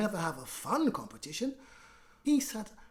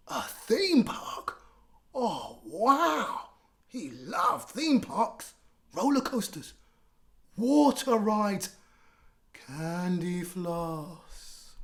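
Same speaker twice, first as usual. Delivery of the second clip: much higher, slow, awed theme park; thrilled; 3 semitones higher, 7 dB louder, much slower than usual. Acted. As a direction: savor each treat with delight. Henry thrilled about theme park.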